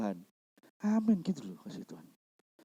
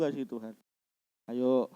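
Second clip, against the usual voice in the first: usual speaking voice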